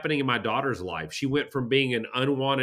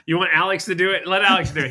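teasing; 6 semitones higher, 7 dB louder, faster than usual. Real, not acted. Teasing a friend to perform.

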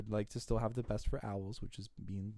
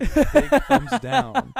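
laughing emphatic no; 13 semitones higher, 14 dB louder, much slower than usual. Real, not acted. Reacting to a bad idea.

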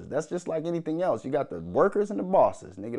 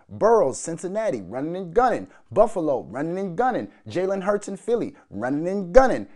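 building rant; 6 semitones higher, 5 dB louder than usual. Real, not acted. Building list chant.

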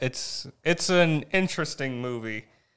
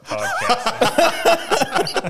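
laughing; 12 semitones higher, 6 dB louder, much slower than usual. Real, not acted. Teasing hosts while laughing.

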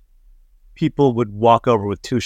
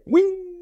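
very high squeak as you toss the shot; mock flinging cry. Drunk comedian tossing shot over his shoulder, high yelp. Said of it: "I just go like this".

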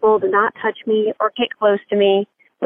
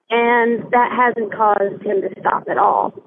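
normal talking voice

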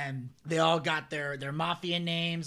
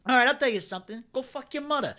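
lightly voiced defiant wiseguy, pitched higher; Joe Pesci; 6 semitones higher, 4 dB louder than usual. Storyteller plays Pesci answering the cop, higher, defiant wiseguy impression. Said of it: "you got his, uh, impression down".

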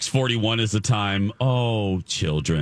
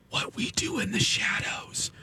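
whispering; 10 semitones higher, 7 dB quieter than usual. Real, not acted. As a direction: drop to a hush. End of nominee list.

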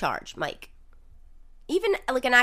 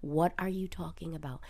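close-mic whisper; 6 semitones lower, 9 dB quieter than usual. Real, not acted. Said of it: "get close to the mic and whisper".

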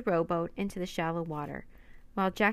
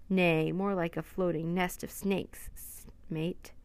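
usual speaking voice

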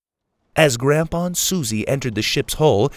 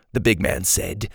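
plain reading voice

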